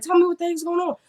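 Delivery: high, urgent playful demand; high-voiced pretend panic; mock alarm. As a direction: pitch it way up, mock-frantic. Echoing the alarmed demand herself.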